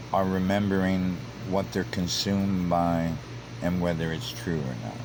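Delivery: ordinary delivery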